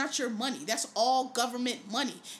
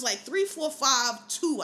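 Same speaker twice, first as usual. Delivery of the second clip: usual speaking voice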